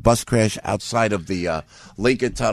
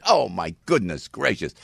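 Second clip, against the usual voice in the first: ordinary talk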